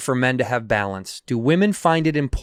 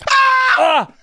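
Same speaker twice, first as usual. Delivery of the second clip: pained yell; 4 dB louder than usual. Real, not acted. Wound being cleaned with Purell.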